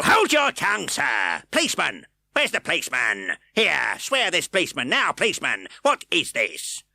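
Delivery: high, loud barked orders; bully the court; blustering magistrate. A narrator's reading. Fang shouting orders.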